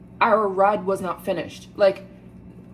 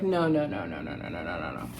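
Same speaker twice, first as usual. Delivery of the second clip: fading no's; 8 dB quieter than usual. Retold or imitated. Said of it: "I'm like".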